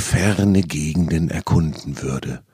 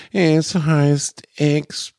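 mock despair; 10 semitones higher than usual. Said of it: "in gespielter Verzweiflung".

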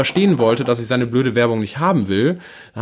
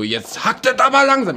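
hammed-up angry retort; retold angry yell; 12 semitones higher than usual. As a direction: snap at the caller, fed up. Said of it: "Ich bin richtig laut geworden".

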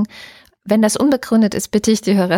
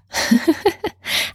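laughing; 10 semitones higher than usual. Real, not acted. Laughs at joke.

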